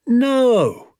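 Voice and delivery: sharp high cry of protest; cried out. Acted. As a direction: blurt it out loud and high. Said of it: "I cried".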